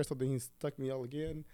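usual speaking voice

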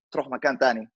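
usual speaking voice

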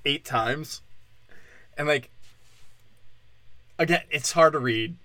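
ordinary delivery

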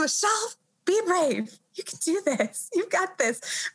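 a hint of an upbeat cheer for herself; self pep talk. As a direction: coach yourself bravely, voice lifted high. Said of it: "I need to do more of that".

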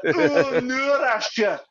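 high Scottish voice, laughing through it; Angie. Retold or imitated. Retelling Angie refusing Asher's bait, high mock Scottish accent. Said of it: "I can't do it Scottish, but she's like".

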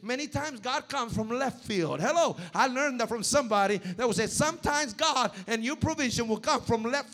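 loud, rising sermon delivery; full-force preaching. Acted. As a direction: preach it out to the congregation.

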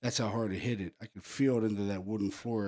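ordinary talk, nothing marked

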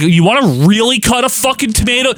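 high, hyped infomercial pitchman. Retold or imitated. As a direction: sell it like a late-night TV ad. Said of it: "they'd be like".

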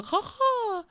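high, slow mocking demon cackle; evil cackle. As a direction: cackle like something escaping hell. Imagined cackle from hell's pits.

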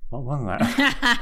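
stretched-out retold bafflement; baffled reaction. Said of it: "I'm just like".

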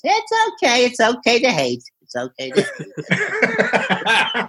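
high, then slowing, laughing; Leno voice. Retold or imitated. Leno forgives the comedian, high then slow and laughing. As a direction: drag out the last line, slow and amused.

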